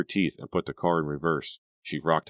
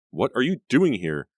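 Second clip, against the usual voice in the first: higher, louder angry shout; furious shout; 8 semitones higher, 5 dB louder than usual. A narrator's reading. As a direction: shout at her in fury. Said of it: "she shouted".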